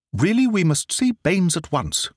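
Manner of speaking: spring into action with a cry; cried urgently. Acted. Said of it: "cried Holmes".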